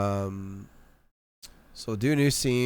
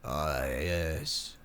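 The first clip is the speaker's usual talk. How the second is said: usual speaking voice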